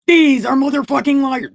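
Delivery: high, loud accusation; angry outburst. Acted. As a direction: burst out loudly in outrage. Outburst while reading aloud.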